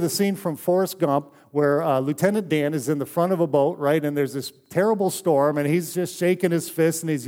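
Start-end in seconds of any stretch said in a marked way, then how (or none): none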